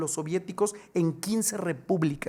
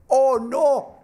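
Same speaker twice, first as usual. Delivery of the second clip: sudden high, loud cry of alarm; 7 semitones higher, 8 dB louder than usual. Retold or imitated. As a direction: blurt it out, suddenly scared. Narrator realises he's locked in. (grito de alarma agudo y fuerte)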